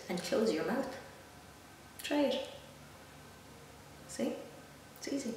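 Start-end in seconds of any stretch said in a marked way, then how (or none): none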